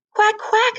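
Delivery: high quacking mother duck; bright duck call. A narrator's reading. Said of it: "said the duck".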